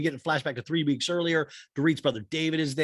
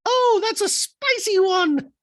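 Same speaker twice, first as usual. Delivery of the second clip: very high squeaky voice with beep boop robot noises; high mock robot Dorit; 8 dB louder, slower than usual. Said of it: "'cause Dorit's like".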